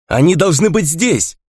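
high, urgent cry; shouting. A narrator's reading. Young fighter to comrades about unseen beasts, shouting. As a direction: cry out with pitch well up. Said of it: "закричал один из датитцев".

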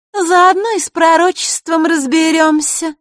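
high, loud, drawn-out taunt; teasing. A narrator's reading. Marishka chimes in to provoke Vasilisa, loudly teasing. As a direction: goad her in a high sing-out voice. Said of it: "словно раздразнивая, вставила Маришка".

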